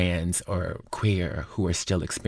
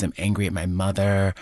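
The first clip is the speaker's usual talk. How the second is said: ordinary talk, nothing marked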